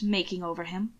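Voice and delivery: plain reading voice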